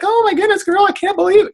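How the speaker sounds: squealing very high, gushing; over-the-top cheerleader. Retold or imitated. Coach imitating gushing all over a client, squealing high. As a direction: shriek with delight at a friend. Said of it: "I'm not gonna be all over".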